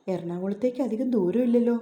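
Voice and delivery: ordinary delivery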